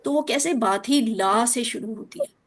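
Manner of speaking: ordinary delivery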